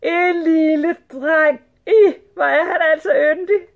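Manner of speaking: high, loud, gushing women; excited shouting. A narrator's reading. Sultan's wives adoring the little boy, squealing. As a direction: gush shrilly over a cute child. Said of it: "råbte de".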